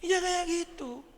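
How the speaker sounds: high, slow put-on voice; mock whiny reply. Preacher mimicking a touchy person, high and slow. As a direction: drawl it like a sulky child.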